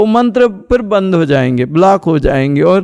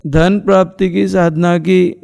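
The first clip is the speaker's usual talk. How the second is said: ordinary talk, nothing marked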